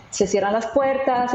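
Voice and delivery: usual speaking voice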